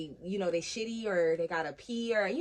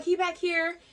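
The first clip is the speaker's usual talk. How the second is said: calling out; 9 semitones higher, 3 dB louder, slower than usual. Retold or imitated. Mother-in-law calls back.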